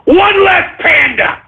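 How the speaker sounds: high, drawn-out punchline; defiant yell. Real, not acted. Host dismissing upset over a dead panda, defiant high yell. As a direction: holler it high and slow.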